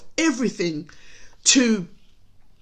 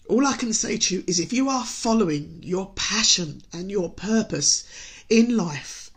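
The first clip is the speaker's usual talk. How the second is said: normal talking voice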